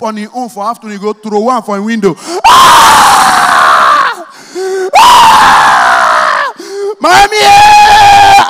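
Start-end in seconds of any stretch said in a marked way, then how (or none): 2.5-6.5 loud high wail; beaten child scream. Retold or imitated. Child screaming as the mother beats him. 7.0-8.5 loud high cry for mommy; child crying out. Retold or imitated. Beaten child crying out to his mother.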